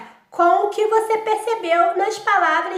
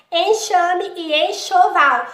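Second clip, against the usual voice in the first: slow, clear word reading; slower than usual. Shown as a demonstration. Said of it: "percebeu aí diferente".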